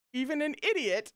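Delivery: high, mocking crow rising into laughter. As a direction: crow it high, about to cackle. Crowing speaker continues, breaking into laugh.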